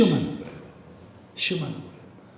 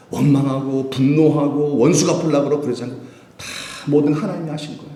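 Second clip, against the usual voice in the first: ordinary talk, nothing marked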